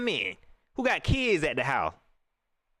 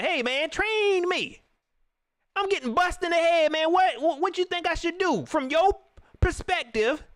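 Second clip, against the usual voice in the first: higher, pleading boxer voice; a boxer whining to his trainer for help; mock boxer begging; 9 semitones higher than usual. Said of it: "got to tell the trainer".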